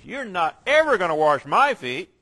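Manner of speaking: "ever" punched, loud and falling; emphatic never. Shown as a demonstration. Peter flatly refusing the Lord, stress on "ever". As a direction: hammer the word "ever". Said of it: "the strongest way of saying never".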